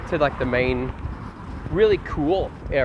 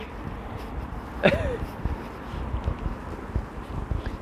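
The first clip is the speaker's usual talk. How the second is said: soft airy laugh, much higher and softer; long wheezing laugh; 9 dB quieter than usual. Real, not acted. Streamer losing it over his own rant, airy laugh. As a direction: let the laugh run out breathless. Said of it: "laughs at himself".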